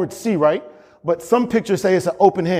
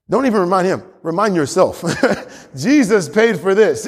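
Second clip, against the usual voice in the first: ordinary talk, nothing marked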